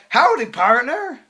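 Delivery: high, loud, drawn-out howdy; goofy mock greeting. Girl plopping down next to him, goofy high mimicry. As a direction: imitate a hokey cowgirl hello.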